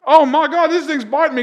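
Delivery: high loud panic; panicked yell. Retold or imitated. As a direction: yelp in pain and fear. Getting bitten by police dog.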